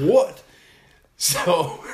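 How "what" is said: sharp, high, loud startled what; a sudden loud, high gasp of a question; shocked what. Retold or imitated. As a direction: blurt it out in disbelief. Shocked reaction to eyebrows.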